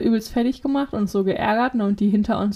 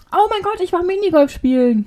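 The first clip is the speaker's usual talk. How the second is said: high, sudden burst of excitement; excited remembering; 9 semitones higher, 3 dB louder than usual. Real, not acted. Friend suddenly remembers what they did on Friday, excited. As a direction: exclaim it as the memory hits.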